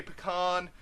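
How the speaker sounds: pecan with an open "ah"; pecan stressed on "kahn"; PECAN as puh-KAHN. Shown as a demonstration. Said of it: "those of you who say".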